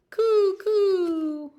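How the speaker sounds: high, loud cooing; dove coo. A narrator's reading. Reading the doves to a child, high cooing.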